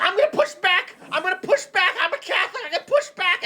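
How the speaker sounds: high, petulant mock voice; squeaky defiant brat insisting; whiny J D Vance. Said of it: "J D Vance is like".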